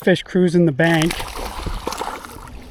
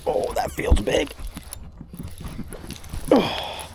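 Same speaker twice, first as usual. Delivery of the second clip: whispered, breathless oh; hushed; 7 semitones higher, 9 dB quieter, slower than usual. Real, not acted. Angler feeling a big bite, whispering to himself. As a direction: barely voice it as the fish hits. Fish strikes, hushed tension.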